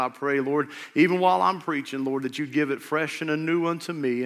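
earnest pulpit prayer. Acted. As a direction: pray it aloud for the room. Leads the congregation in prayer.

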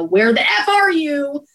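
tearful demand at the lost crew; desperate on the radio. Retold or imitated. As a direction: dispatcher tearfully demanding the engine's location on the radio. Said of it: "on the radio".

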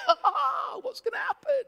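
high, loud mock-desperate wail; hammed-up cliffhanger anguish; agonised TV viewer. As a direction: cry out as if the episode just cut off.

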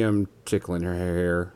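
usual speaking voice

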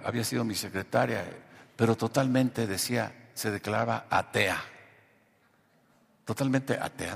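ordinary delivery

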